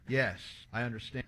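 low, hushed acknowledgement; subdued reply. Retold or imitated. Dying grandfather answering his grandson, subdued. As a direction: drop low and soft to concede it.

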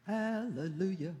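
very soft, slow praise; subdued hallelujah. Real, not acted. Preacher after the rousing climax, subdued hallelujah.

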